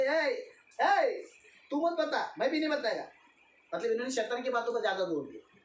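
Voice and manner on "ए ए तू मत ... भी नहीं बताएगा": sly tempter. Acted. Voicing Satan's whisper to people.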